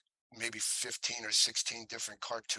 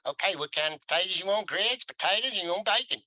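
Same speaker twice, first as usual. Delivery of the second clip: put-on Southern waitress accent, much higher and louder; North Carolina diner waitress; 9 semitones higher, 7 dB louder than usual. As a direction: mimic a Carolina diner gal rattling off the menu. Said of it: "she's chewing gum, and she goes".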